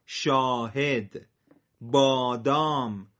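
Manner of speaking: بابا ماه داس دام کار ساعت شاهد بادام with each long aa held slowly; drawn-out long vowels. Shown as a demonstration. Teacher drilling Persian long vowel words slowly and loudly.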